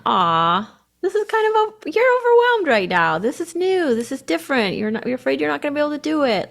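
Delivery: gentle cooing reassurance; tender self-compassion. Retold or imitated. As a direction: soothe a scared child. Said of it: "slowing and".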